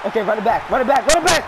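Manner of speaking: building to an excited shout; mounting excitement. Real, not acted. Urging a player downfield in game.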